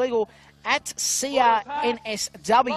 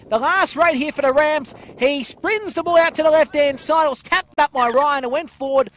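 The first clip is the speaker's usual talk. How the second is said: louder, higher, building play-by-play; excited final raid call; 4 semitones higher, 4 dB louder than usual. Real, not acted. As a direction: call the last raid with rising urgency.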